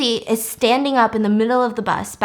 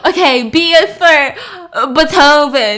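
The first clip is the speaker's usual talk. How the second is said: louder, laughing through the words; 3 semitones higher, 6 dB louder than usual. Real, not acted. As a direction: talk while cracking up. Laughing while narrating.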